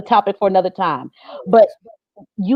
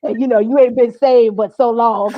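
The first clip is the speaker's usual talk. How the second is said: laughing outburst; 3 semitones higher than usual. Retold or imitated. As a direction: crack up mid-quote. Quotes husband, bursts laughing.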